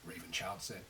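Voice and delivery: ordinary delivery, nothing marked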